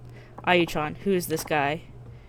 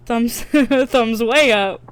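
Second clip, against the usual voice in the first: loud, slow, laughing praise; can't contain the delight; gushing excitedly; 4 semitones higher, 9 dB louder, much slower than usual. Real, not acted. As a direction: onlooker thrilled by the scene. Thrilled onlooker gushing.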